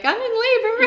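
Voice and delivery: squeaky high, bubbly Insta voice; mock her own chirpy story post; high sing-song social media chirp; influencer voice.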